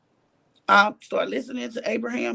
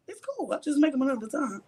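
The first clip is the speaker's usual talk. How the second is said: soft, placating retold answer; calm reply; 3 semitones higher, 4 dB quieter than usual. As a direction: imitate letting it go, softly. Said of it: "every argument how calm I am".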